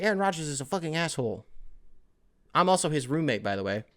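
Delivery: mock the bitter roommate's online meltdown, softly; imitating ranting roommate. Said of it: "being like".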